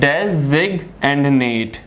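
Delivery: designate very slowly as "des, ig, and nate"; teacher sounding out designate piece by piece; syllable by syllable. Shown as a demonstration. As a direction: break designate into three separate parts. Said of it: "third syllable".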